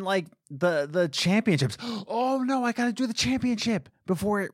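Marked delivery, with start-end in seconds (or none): 1.8-4.5 gasping, higher, real dismay; oh no, just realized it's too late; sudden gasp of alarm. Real, not acted. Remembers he missed the championship.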